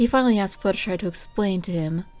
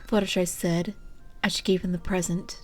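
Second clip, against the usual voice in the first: ordinary delivery, nothing marked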